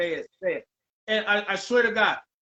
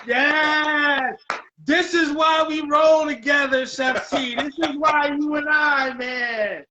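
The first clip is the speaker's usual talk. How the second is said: loud, high, rousing cheer; real excitement; 4 semitones higher, 3 dB louder than usual. Real, not acted. Host cheering the chef's loyalty, thrilled. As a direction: celebrate at full voice. Celebrating friend's promise to share success.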